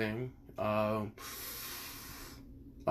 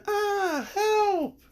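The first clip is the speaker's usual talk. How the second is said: high mock girly scream for help, much higher, half laughing; imitated girl scream. Girl trapped under the rubble calling for help, high laughing mock scream. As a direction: squeal it like a damsel in distress, breaking into a laugh. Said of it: "a girl scream".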